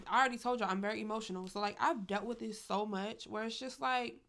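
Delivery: ordinary delivery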